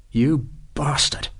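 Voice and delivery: soft, slow curse. A narrator's reading. Sharp cursing the sergeant under his breath, soft. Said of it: "Sharp said softly".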